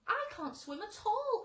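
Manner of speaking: high, loud worried cry. A narrator's reading. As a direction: cry out in fright. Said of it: "cried the ladybird".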